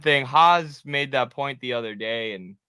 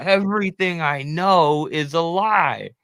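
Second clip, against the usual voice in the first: mock disillusion; 5 semitones higher, slower than usual. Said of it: "They're like".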